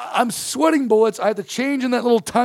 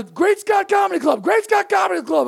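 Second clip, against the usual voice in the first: urgent shout; 9 semitones higher than usual. Retold or imitated. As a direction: bark the destination twice at the cabbie. Urgently telling cab driver destination.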